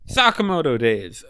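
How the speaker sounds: hyped shout. Real, not acted. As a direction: cheer the title out. Repeating the title for hype.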